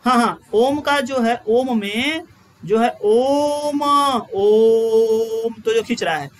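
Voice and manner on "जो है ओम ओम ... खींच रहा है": slow, stretched ओम; drawn-out om. Shown as a demonstration. Teacher showing pluta with a drawn-out ओम. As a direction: stretch the ओम long at the end. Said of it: "ऐसे last में बोलते हैं खींच खींच के".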